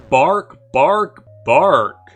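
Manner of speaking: hammed-up barking; mock dog barks. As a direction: yap like a dog. Voicing an unseen dog.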